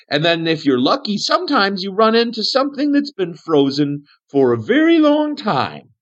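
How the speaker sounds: high, swooping voice of an odd survivor; Aniston, dotty cave hermit. Acted.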